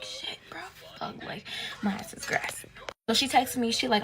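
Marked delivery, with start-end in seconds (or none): 0.0-2.6 hushed panicked whisper, breath only; scared whispered curse. Retold or imitated. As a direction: whisper it so the parents won't hear. Panicking as parents wake.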